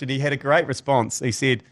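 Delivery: ordinary talk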